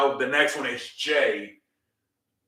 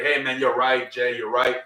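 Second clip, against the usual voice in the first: ordinary talk, nothing marked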